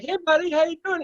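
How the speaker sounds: mock upbeat greeting to a wounded dog; cheery greeting. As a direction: sing out a hearty hello to a hurt dog. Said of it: "you come up on him and you're like".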